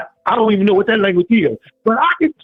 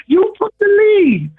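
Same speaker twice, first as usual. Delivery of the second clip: preaching rant; 7 semitones higher than usual. Real, not acted. Caller preaching at the players.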